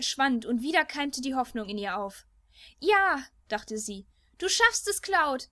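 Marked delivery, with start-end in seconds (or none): 2.8-3.2 high, bright burst of hope; hopeful cry. Acted. Hope rising as Cloud attacks. 4.4-5.5 high, loud cheer of encouragement; hopeful cheer. Acted. Tifa cheering Cloud on.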